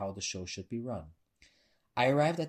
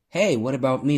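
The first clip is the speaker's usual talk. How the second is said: loud, high jovial butt-in; laughing call; 5 semitones higher, 13 dB louder than usual. A narrator's reading. Butch Jan to friend buying beers, jovial call. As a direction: butt in loudly with teasing good humour. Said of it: "Butch Jan laughed".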